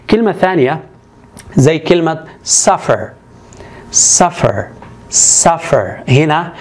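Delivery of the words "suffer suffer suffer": suffer with SUF stressed; stress on first syllable. Shown as a demonstration. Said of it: "التشديد على المقطع الأول".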